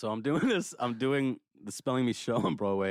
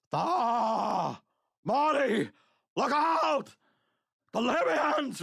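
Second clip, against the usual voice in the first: high, loud frantic mad-scientist yell; panicked inventor shouting a warning; Christopher Lloyd impression; 5 dB louder, much slower than usual. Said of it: "my best, like, Christopher Lloyd".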